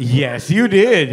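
normal talking voice

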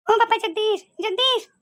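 a scared aunt crying out for Jagdish; frightened calling out. Acted. Scared aunt calls her nephew after the ghost story, rising fright.

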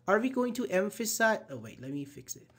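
ordinary talk

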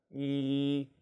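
long level 이 with lips stretched wide; wide-lipped 이. Shown as a demonstration. Teacher modelling the vowel, 이 spread and sustained. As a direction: stretch the mouth and hold 이. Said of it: "입을 찢으면서".

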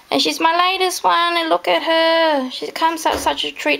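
high, excited showing off; proud gush. Real, not acted. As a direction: beam with pride over the doll. Presenting her latest doll.

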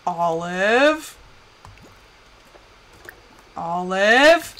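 low call swooping up at the end; calling a pet. Pretend. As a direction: call the pet with a rising question.

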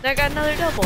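thrilled announcement; excited. Real, not acted. Celebrates double kill.

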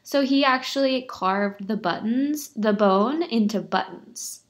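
ordinary delivery, nothing marked

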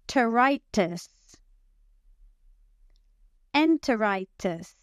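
slow back-chaining, adding a syllable each time; syllable build-up. Shown as a demonstration. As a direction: build "Gastroenteritis" from the end, slowly. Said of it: "add a syllable each time".

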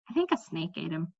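a dragon's friend muses over her tea, quietly. A narrator's reading. Soft thoughtful musing. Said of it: "she added thoughtfully".

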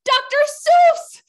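high, loud, drawn-out reveal; excited announcement. Pretend. Host revealing whose home it is, excited announcement. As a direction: announce the big name with a shout.

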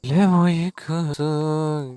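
slow, stretched "Ölüm uykusu"; slow mock-ominous "Ölüm uykusu"; drawn-out. Shown as a demonstration. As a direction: drag out "Ölüm uykusu" like a spooky title.

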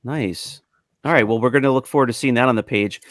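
ordinary talk, nothing marked

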